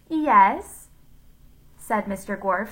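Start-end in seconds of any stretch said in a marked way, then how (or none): 0.1-0.9 put-on high, loud girlish "Yes?"; Leslie. A narrator's reading. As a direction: answer in a borrowed girl's voice. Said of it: "still in Leslie's voice".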